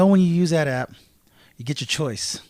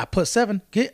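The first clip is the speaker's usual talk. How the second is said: usual speaking voice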